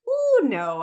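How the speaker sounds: a sudden high alarmed "ooh, no"; startled oh no. Retold or imitated. Retold telling husband she needs space.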